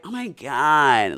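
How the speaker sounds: loud, drawn-out mock Valley Girl. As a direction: exaggerate it like a Valley Girl, slowly. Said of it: "that Valley Girl shit".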